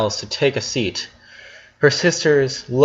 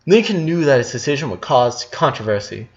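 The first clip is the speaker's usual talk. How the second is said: ordinary delivery, nothing marked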